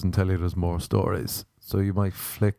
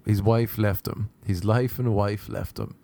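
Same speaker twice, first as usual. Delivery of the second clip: usual speaking voice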